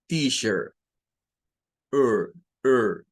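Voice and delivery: "Teacher" with an American accent, the r sounded at the end; American accent, curl the final r of "Teacher"; American accent: "Teacher" ending in a clear r. Shown as a demonstration. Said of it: "vendría sonando como teacher".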